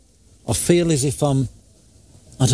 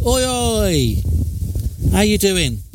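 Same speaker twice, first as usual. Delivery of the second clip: cheeky call through the door, a little higher; calling out playfully to Liam; mild mock shout; 4 semitones higher, slower than usual. Calling through the bathroom door to her mate, a mild cheeky shout. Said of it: "So I shouted in a way to Liam".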